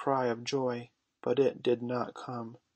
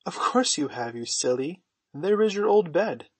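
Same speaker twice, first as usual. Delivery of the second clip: louder, brisk correction of a little brother; impatient sibling; 6 semitones higher, 7 dB louder than usual. A narrator's reading. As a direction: set him straight, rising high and loud at the end. Brother scoffs at doubting Michael.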